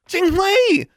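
high, loud panicked scream. A narrator's reading. Anna screams as an owl attacks hers, panicked. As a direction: scream the owl's name in terror. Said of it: "Anna screamed".

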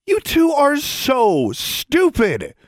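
high, loud, drawn-out outburst; exasperated scolding. A narrator's reading. Alexandra berates both squabbling girls, exasperated. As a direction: stretch it out in angry exasperation.

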